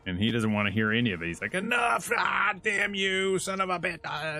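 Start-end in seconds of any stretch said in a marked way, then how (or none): 1.5-4.4 mock enraged tirade. Reviewer imitates Kratos cursing his fate, hammed-up angry yell. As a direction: rant like a hammed-up furious warrior. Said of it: "can't seem to stop being pissed off".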